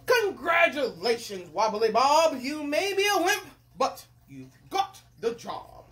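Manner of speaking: loud, grand announcement; congratulating king. Acted. King awards Wobbly Bob the job, grand. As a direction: proclaim it ceremoniously.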